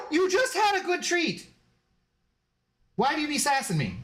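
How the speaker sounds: high-pitched scolding of a pet; pet-talk voice, mock stern; high dog-talk voice. Real, not acted. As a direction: scold the dog in a squeaky sing-song.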